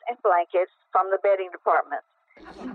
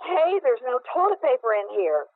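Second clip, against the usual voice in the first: higher-pitched calling-out, no real volume; mock yell; 5 semitones higher than usual. A narrator's reading. Husband calling from inside a fitting room, mock yell. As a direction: call out as if through a door. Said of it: "then yelled lou-, lou-, loudly".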